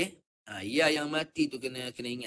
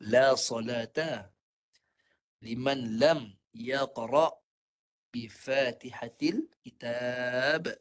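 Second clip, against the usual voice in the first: slow, measured Arabic recitation; measured chant of an Arabic hadith; 2 semitones lower, much slower than usual. Acted. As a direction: recite it slowly like scripture.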